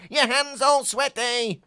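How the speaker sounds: squeaky-high film quote; Wallace voice. Retold or imitated. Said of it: "He sounds like Wallace and Gromit".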